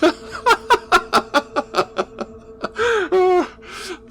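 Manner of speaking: high manic cackle of a madman; insane laughter. Acted. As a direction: burst into a mad high cackle. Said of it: "the sound of his insane glee".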